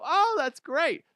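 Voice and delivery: high, drawn-out gush; mock delighted reply. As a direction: gush slowly in a put-on high voice. Voicing imagined delighted shark.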